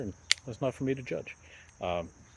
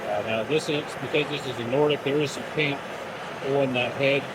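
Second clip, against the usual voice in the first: raised voice over noise; 2 semitones higher, 5 dB louder than usual. Real, not acted. Talking over running torch.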